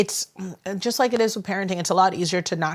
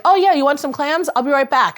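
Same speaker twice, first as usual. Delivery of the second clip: eager local; 6 semitones higher, 5 dB louder than usual. Retold or imitated. Mimics an eager Maine local.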